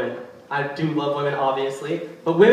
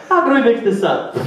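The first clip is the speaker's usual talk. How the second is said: higher mock fawning officer; fawning cop; 5 semitones higher than usual. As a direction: repeat the groveling line. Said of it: "Aw, so dumb".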